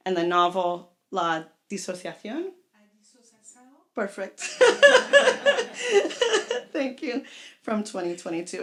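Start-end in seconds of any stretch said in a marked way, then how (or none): 4.4-6.7 high, loud burst of embarrassed laughter. Real, not acted. Host laughs at her own mix-up in front of the audience, real embarrassed laugh. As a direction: laugh it off after a slip. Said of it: "It's always nice to be humbled".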